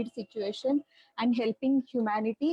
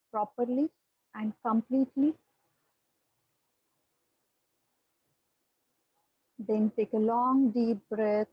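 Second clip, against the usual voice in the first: guided meditation. Acted. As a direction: ease them into their breath, unhurried. Leads a guided breathing meditation.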